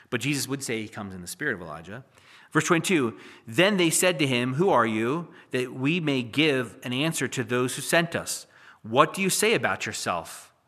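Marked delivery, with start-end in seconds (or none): 3.4-10.5 loud, measured reading of scripture. Acted. Preacher reads Isaiah to his congregation, loud and solemn. Reads John chapter one aloud.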